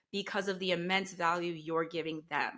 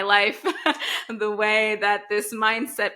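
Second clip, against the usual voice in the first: laughing; 4 semitones higher, 10 dB louder, slower than usual. Real, not acted. Laughs plugging her Instagram.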